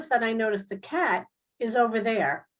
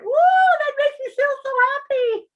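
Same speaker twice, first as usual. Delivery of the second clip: much higher, loud mock cheer; sarcastic woo; 8 dB louder than usual. Real, not acted. Mocking her own luck with a high fake cheer. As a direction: fake delight dripping with sarcasm.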